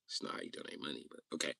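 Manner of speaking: soft unvoiced mutter under the breath; muttered aside. Real, not acted. Streamer muttering about a weird donation. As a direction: say it to yourself, barely voiced.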